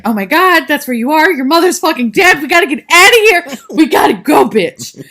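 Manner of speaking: high, loud panicked shouting; frantic mock yell. Retelling Sydney urging the girls to flee, in a loud frantic yell. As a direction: yell at them to get out now. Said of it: "Sydney's like".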